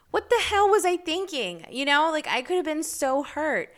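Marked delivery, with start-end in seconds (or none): 0.1-1.7 high, loud, exasperated at herself; what on earth was I thinking, loud and high; incredulous outburst. Real, not acted. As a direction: cry out in disbelief at your own past. Exclaims disbelief at own stunt.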